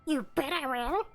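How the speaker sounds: high squeaky gremlin voice. Acted. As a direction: chirp it like a little gremlin. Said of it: "little gremlin voice".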